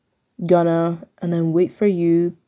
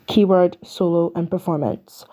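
ordinary talk

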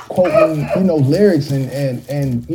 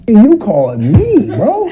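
incredulous; 6 semitones higher, 4 dB louder, slower than usual. Retold or imitated. Retold disbelief at being put on hold.

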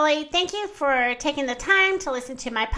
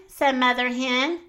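usual speaking voice

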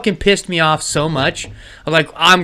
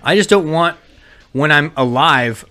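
ordinary delivery

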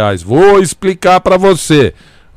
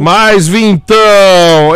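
hype shout; 4 semitones higher, 3 dB louder, much slower than usual. Acted.

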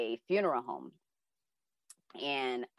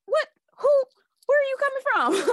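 high, louder shocked questions; startled; 10 dB louder, faster than usual. Retold or imitated. Child startled as [name] sits down, high and loud. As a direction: jolt up in disbelief.

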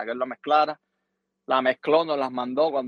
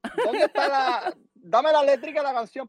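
hammed-up radio woman; 10 semitones higher, 4 dB louder than usual. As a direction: squeal it like the flustered host. Radio woman demanding a translation.

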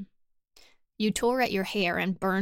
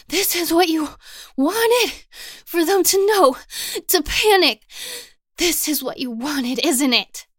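much higher and louder, breathless from running; panting; 9 semitones higher, 11 dB louder than usual. Acted. Princess racing behind you, breathless and loud. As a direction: gasp it out high and loud after the sprint. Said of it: "she pants".

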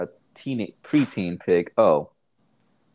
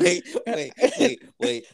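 laughing; slower than usual. Real, not acted. Host cracks up at joke.

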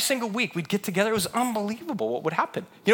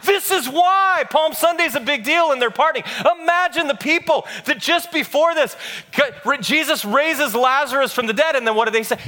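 raised, driving preacher's voice; impassioned preaching; 5 semitones higher, 4 dB louder than usual. Acted. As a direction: preach it with building fervor. Preaches at full force.

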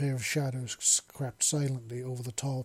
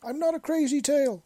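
ordinary delivery

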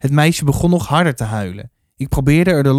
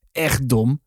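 ordinary delivery, nothing marked

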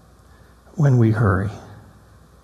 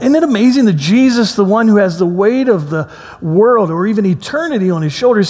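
normal talking voice

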